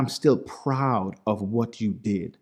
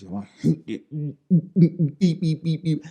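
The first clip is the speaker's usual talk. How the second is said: imitated bleeping over swear words; quick spoken beeps standing in for cussing; mimicked censor beeps; 5 semitones higher than usual. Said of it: "I'm cussing them out".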